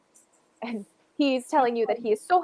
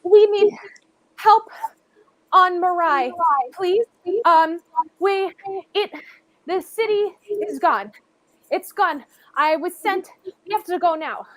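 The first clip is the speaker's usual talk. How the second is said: put-on high, loud frightened boy's plea; panicked child begging strangers for help; scared boy pleading; 6 semitones higher, 6 dB louder than usual. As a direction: beg for help as a desperate young boy. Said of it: "he looks at you and he says".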